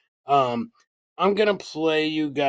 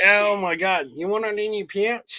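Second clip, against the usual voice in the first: mock Australian accent; 4 semitones higher than usual. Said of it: "do your Australian accent".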